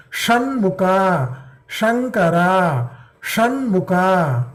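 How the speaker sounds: Shanmukha and Shankara drawn out slowly; slow it right down to contrast the two sha sounds; teacher slowly contrasting Shankara and Shanmukha; slow careful contrast. Shown as a demonstration.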